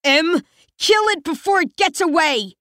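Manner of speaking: high furious cry; shaking with anger. Acted. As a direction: yell it out in sheer fury. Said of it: "go wide with sheer fury".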